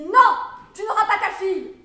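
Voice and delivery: imitating the nurse's harsh refusal; high, loud mimicked order; shouted refusal. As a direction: yell it as a forbidding official. Quotes nurse refusing her the daughter.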